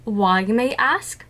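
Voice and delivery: normal talking voice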